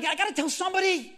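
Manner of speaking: high, loud acted outburst; urgent unloading. Preacher voices Moses needing it off his chest, urgent outburst. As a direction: blurt it out as Moses, bursting to tell.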